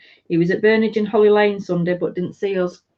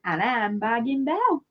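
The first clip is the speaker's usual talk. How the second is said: softer, failed stab at Australian; bad Aussie accent; 3 semitones higher, 6 dB quieter than usual. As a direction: introduce yourself in a badly faked Australian accent. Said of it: "I can't do an Aussie accent".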